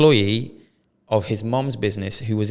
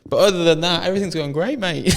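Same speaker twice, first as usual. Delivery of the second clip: laughing; 9 semitones higher, 5 dB louder than usual. Real, not acted. Laughing after scam story.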